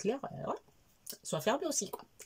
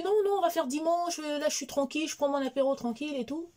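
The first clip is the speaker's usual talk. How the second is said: much higher mock voice of a lazy husband; mocking her husband; 9 semitones higher than usual. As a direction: imitate the husband, higher and teasing. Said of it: "Il me dit". (imite son mari d'une voix plus aiguë)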